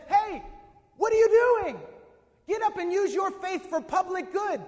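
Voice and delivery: high, loud shout to rouse a sleeper; calling out. Acted. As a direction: holler at him, urgent and high. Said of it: "calls out to him".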